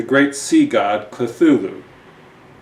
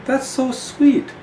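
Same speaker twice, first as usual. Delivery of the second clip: high, slow swooning coo; gushing Gash; slower than usual. Acted. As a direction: melt over the queen's words. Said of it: "says the Crimson Gash".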